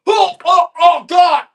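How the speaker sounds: high, loud hammed-up dread; mock gagging panic. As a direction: cry out as if choking on a tongue press. Mimes gagging on tongue press.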